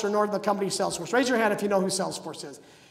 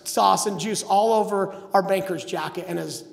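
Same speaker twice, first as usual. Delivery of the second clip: ordinary delivery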